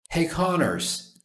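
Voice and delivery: high, drawn-out call to a colleague. A narrator's reading. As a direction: call down the stairs for attention. Officer calls from top of stairs.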